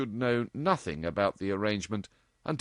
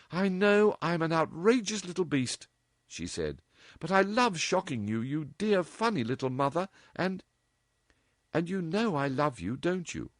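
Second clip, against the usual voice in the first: higher, teasing and fond; lightly voiced affectionate girl; 9 semitones higher than usual. A narrator's reading. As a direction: coax her mother fondly. Dorothy voiced.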